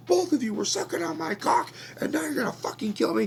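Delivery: high, loud furious yelling; screaming rant. Retold or imitated. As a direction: scream it at them, outraged. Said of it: "starts screaming at them".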